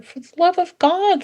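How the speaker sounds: high-pitched mock exasperation; acted high-voiced protest; exasperated dog. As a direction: plead like a dog fed up with strangers. Dog fed up with stranger's petting.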